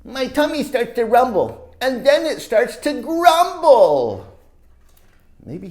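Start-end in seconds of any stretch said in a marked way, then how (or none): 0.0-4.2 building read-aloud. A narrator's reading. Teacher reading the volcano build-up to class. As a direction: build the suspense for the kids.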